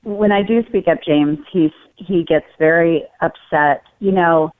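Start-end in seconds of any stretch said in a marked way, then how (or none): none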